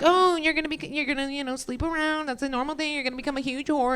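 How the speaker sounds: high, soft mock know-it-all; mocking people. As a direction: lightly parody the condescending advice. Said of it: "they're always like".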